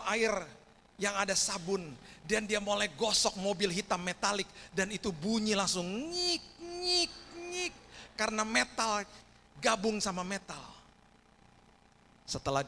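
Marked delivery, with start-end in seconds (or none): 4.7-7.7 squeaky scrubbing noise. Retold or imitated. Scrubbing noise of wire on car.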